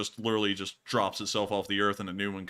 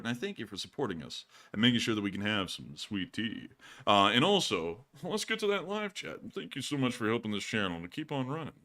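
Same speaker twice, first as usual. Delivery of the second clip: ordinary talk, nothing marked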